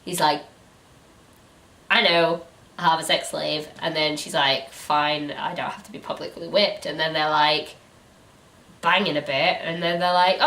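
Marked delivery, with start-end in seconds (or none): none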